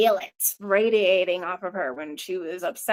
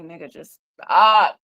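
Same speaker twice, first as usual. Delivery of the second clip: loud retort; 4 semitones lower, 8 dB louder than usual. Retold or imitated. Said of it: "And it's like".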